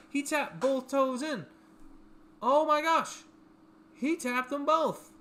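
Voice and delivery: high, slowed-down awe; stunned fan reliving the catch; amazed excitement. Real, not acted. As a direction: draw each word out in loud disbelief. Amazed at the toe-tap catch.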